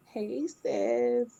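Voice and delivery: normal talking voice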